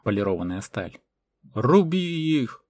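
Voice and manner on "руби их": high, loud, drawn-out battle cry; long raised shout; desperate yell. A narrator's reading. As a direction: yell a desperate order to attack. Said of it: "отчаянно заорал Мартан".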